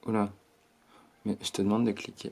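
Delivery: ordinary talk, nothing marked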